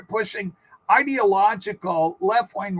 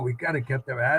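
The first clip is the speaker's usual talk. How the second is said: low mock quote; 7 semitones lower, 5 dB quieter than usual. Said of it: "shaking her head going".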